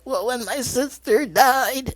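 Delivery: high, slow imitated weepy voice; mock sad. Aunt confessing about her dead sister, mock sad. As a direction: draw it out in hammed-up grief. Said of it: "she was all sad and crying".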